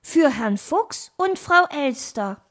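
put-on high young-animal voice; young fox. Nephew reads the envelope aloud, high young voice. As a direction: read it out as the little nephew.

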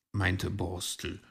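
ordinary delivery, nothing marked

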